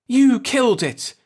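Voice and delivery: loud, high cry of blame; furious accusation. A narrator's reading. Old man shouting his blame at Mikaelson. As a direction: hurl it at him, loud and high.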